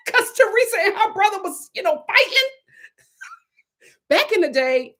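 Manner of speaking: ordinary talk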